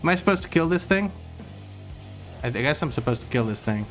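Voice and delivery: ordinary delivery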